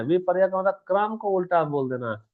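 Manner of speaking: ordinary talk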